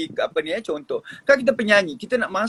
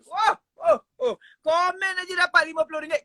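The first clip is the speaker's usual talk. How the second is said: higher, fast, hammed-up wonder; mock amazement; 10 semitones higher than usual. Real, not acted. Host jokes about a comment winning money, higher and hammed up. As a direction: play up the surprise for laughs.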